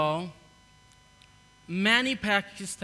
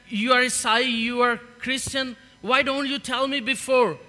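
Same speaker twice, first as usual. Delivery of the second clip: higher, louder mock outrage; retold angry yell; 5 semitones higher, 5 dB louder than usual. Owner scolding the boy for hiding his faith, retold angry shout. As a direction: spit out the slur, indignant.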